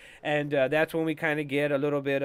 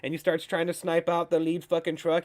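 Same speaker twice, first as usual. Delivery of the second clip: ordinary talk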